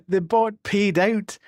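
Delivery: loud, high and jubilant; excited commentator-like outburst; thrilled amazement. Real, not acted. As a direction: crow over a rare win, laughing between phrases. Celebrating rare payout.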